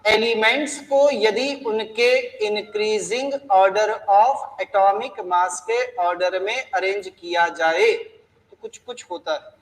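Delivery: deliberate slow reading of the law, word by word; teacher reading a definition slowly for students to note; slow dictation. Shown as a demonstration. Teacher repeating the law to class, slow and deliberate. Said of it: "उन्होंने क्या कहा फिर से सुन लो।".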